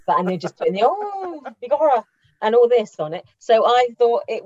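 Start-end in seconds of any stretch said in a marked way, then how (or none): 0.8-2.0 exaggerated Irish accent with a high, swooping "Oh"; stage-Irish exclamation, badly faked; mock Irish accent. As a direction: put on a hammy, unconvincing Irish accent. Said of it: "the accents were all over the place".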